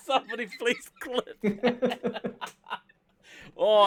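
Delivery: loud laughing tease; cracking up while teasing. Real, not acted.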